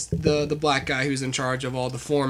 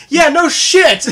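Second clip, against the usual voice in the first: much higher and louder, a mock-exasperated "yeah, no shit"; sarcastic retort; 12 semitones higher, 11 dB louder, slower than usual. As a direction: blurt out the obvious with hammed-up sarcasm. [name]'s sarcastic reply.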